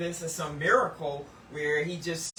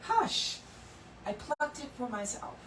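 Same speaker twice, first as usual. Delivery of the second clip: gentle master gardener; 6 semitones higher than usual. Acted. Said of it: "the master gardener says".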